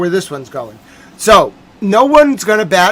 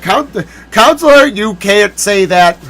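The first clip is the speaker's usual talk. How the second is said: stern Justice; 3 semitones higher, 7 dB louder, slower than usual. Pretend. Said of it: "They'd be like".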